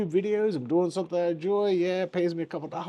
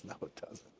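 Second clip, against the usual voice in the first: whispered aside; 23 dB quieter than usual. Real, not acted. Self-rebuttal aside, whispered.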